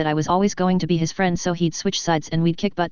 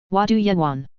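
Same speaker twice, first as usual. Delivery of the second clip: put-on mild growl in an accent. A narrator's reading. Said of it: "Fenrir growled".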